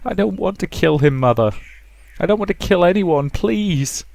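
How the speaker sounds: put-on high, trembling girl begging; shaky pleading. Rosa begging her mother to spare anyone, shaky plea. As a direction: beg her not to make you kill. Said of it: "Her voice shook".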